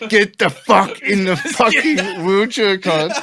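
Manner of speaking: high, slow, laughing mock command; hammed-up exasperation through laughter; exasperated order. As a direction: order him about, drawing out each word. Acted order to stubborn friend.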